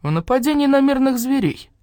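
ordinary delivery, nothing marked

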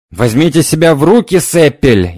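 high loud shriek in a changed voice. A narrator's reading. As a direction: shriek at him in another voice. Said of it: "изменившимся голосом завизжала".

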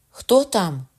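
cry out. A narrator's reading. Margaret calls out at the sudden knocking. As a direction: shout through the door. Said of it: "крикнула вона".

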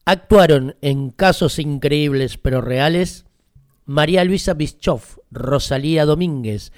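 loud credits announcement; ringmaster. Acted. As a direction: call the names out loudly. Credits read loudly.

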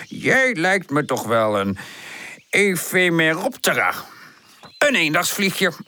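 slow, pondering scholar voice; absent-minded wise professor, delighted. A narrator's reading. (bedachtzame, verrukte professorstem)

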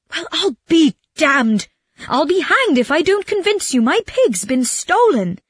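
high, indignant acted outcry; exasperated Calandrino. Robbed man tries to convince his friend, exasperated. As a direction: insist at a high pitch, exasperated.

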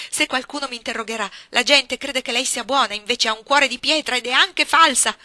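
più alta e più forte, rabbia che cresce; accusa furiosa. A narrator's reading. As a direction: getta ogni parola come un'accusa. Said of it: "in preda a un incontenibile risentimento". (Jane accusa la signora Reed, furia)

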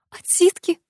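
high shocked question; shocked echo. A narrator's reading. Alex repeats the word in shock, high. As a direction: echo it in disbelief.

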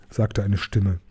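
usual speaking voice

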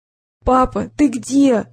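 lightly voiced son, choked and held back; muffled child voice. A narrator's reading. Son calling to his father, muffled and strained. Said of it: "сдавленный голос сына".

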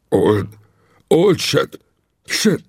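a frightened stammer that rises. Acted. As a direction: choke on the name in terror. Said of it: "hebegte végre".

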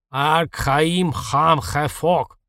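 very slow, drawn-out hoarse monster voice; hoarse mutant growl. A narrator's reading. Alpha mutant struggling with speech, long hoarse growl. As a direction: labour out each word hoarsely, like a beast. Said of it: "долго и хрипло выговорил альфа-мутант".

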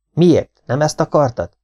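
loud, high-pitched angry challenge; father's shout. A narrator's reading. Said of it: "Apa ordított". (hangos, számonkérő kiabálás)

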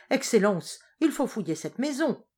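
loud, pleading outcry; a frantic father crying out; desperate cry. A narrator's reading. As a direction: cry out to the general in despair. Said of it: "s'écria le marquis".

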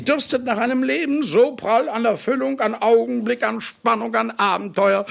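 exalted rapture. Acted. Exalted speech about life's fullness.

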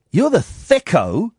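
very slow, drawn-out scorn, climbing; slow mocking scorn. Real, not acted. Radio host taunting a texter who keeps tuning in, very slow scorn. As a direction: spell it out word by word to the fool.

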